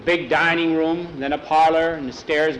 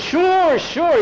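much higher, louder eager yes; flustered agreement; 13 semitones higher, 5 dB louder than usual. Acted. Ranch hand eagerly backing down before the owner, high and flustered. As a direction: blurt it out in a fluster.

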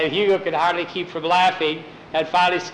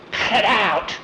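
higher, mock-irritated objection; annoyed protest; 4 semitones higher than usual. Mike pinched while playing the lamb, annoyed protest. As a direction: protest like a pestered kid.

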